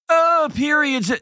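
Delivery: high, slow, drawn-out embarrassed gasp; mock awkward. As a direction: ham up the scandalized dad. Mocking awkward dad reaction.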